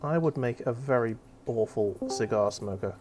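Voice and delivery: talking through a mouthful; words around food; mouth full. Real, not acted. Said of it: "I can't talk with my mouth full".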